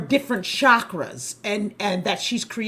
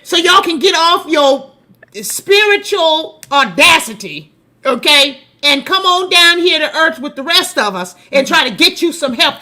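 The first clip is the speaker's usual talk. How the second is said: higher, louder preachy scold; sassy scolding; 6 semitones higher, 8 dB louder than usual. Real, not acted. Host scolding skeptical Christians, loud and sassy. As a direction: tell them off with sass.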